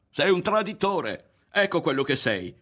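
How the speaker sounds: high, loud angry yell; yelling in his face. A narrator's reading. Berto denounces Ario as a traitor, furious yelling. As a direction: hurl the accusation at full voice. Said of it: "gli urlò in faccia Berto".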